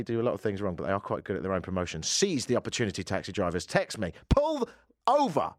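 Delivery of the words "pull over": sudden high holler; mock traffic-stop call. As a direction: hail a driver with a quick high shout. Calling out to taxi drivers.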